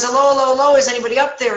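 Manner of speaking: high, loud, hurried hollering; acted desperate call. Trapped man hears footsteps again, acted desperate cry. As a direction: call up as if losing it. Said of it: "like, losing it".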